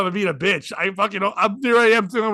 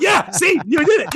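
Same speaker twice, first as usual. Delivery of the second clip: higher and louder, delighted; happy; 8 semitones higher, 5 dB louder than usual. Retold or imitated. Comedian cheers the doctor's joke, delighted. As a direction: cheer that she made the joke. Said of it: "I'm, like, all happy".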